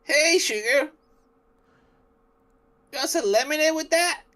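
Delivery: high-pitched put-on Southern belle; Southern accent. Shown as a demonstration. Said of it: "do an accent like that".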